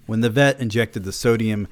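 usual speaking voice

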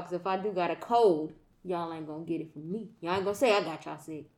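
ordinary talk, nothing marked

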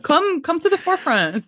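high, playful mock call to Ethel. Fan urging Ethel forward from the corner, playful high call. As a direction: call her up front, teasing.

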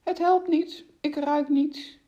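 high-pitched, hammed-up pretence of failing; put-on high complaint; feigned mumble. A narrator's reading. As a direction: feign helplessness, pitched well up. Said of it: "rolde met zijn ogen en mompelde".

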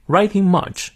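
British English pronunciation demo. Shown as a demonstration. Teacher models British pronunciation for learners, British accent. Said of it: "我们来看一下英式发音".

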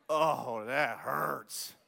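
ordinary talk, nothing marked

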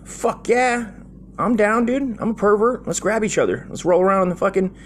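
high, hammed-up kid excitement; squeaky gleeful boy voice, half-joking; mock kid hype. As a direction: play your eager kid self pumped to wrestle. Kid self hyped about wrestling.

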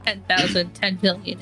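normal talking voice

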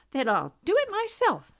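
high, loud hen voice; resolute little hen. A narrator's reading. Little red hen to lazy housemates, resolute. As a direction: declare it briskly to the shirkers.

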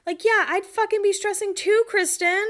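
high-pitched exasperated yeah-obviously; indignant retort. Real, not acted. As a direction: talk back at Kristen, fed up.

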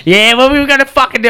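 fired-up mate on the phone; pumped-up reply. Retold or imitated. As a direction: bounce in, eager and loud. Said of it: "I'm just like".

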